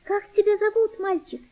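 high-pitched, gentle question; thin, tender girl's voice. A narrator's reading. Girl asks the sick boy his name, tender voice. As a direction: ask kindly in a child's high, thin voice. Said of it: "тонким, нежным голосом".

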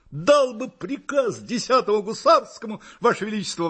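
high, loud, ringing military zeal; eager exclamation. A narrator's reading. Hussar answering his emperor what he would order, exclaiming eagerly. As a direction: burst out like a keen hussar. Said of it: "воскликнул я".

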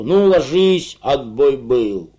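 loud, drawn-out guard's bellow; bellowed moo. A narrator's reading. Duty guard bellowing lights-out through the cell hatch, a slow loud moo. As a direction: moo the order out slowly and loudly. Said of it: "промычит им в кормушку".

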